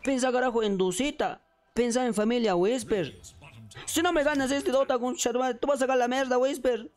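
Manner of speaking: rally the player like an impassioned fan; Bolivian beaver pep talk. Acted. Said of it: "es el castor boliviano apoyando a Whisper".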